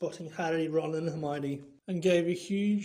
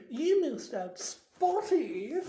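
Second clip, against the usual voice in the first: high, gleeful poltergeist jibe; mischievous stage hiss; hissed taunt; 9 semitones higher, 5 dB louder, slower than usual. A narrator's reading. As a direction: needle her, pitched well up. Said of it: "Peeves hissed in her ear".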